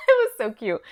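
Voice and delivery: high, loud laugh spilling into words. Real, not acted. Mother gushing about daughter's song.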